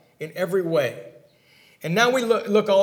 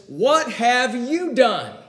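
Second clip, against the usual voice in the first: drawn-out question; 4 semitones higher, much slower than usual. Retold or imitated. Pilate questions Jesus.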